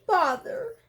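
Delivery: a small grumble of disappointment; mildly annoyed Pooh. Acted. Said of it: "said Pooh".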